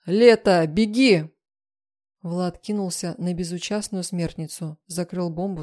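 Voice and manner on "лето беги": loud, urgent shout to run; roared warning. A narrator's reading. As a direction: bellow it as an urgent order. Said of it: "услышала страшный рёв".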